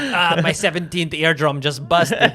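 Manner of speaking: hammed-up alien with a Russian accent; weird Russian accent. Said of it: "they talk in a weird Russian accent".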